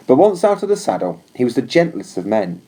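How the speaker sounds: usual speaking voice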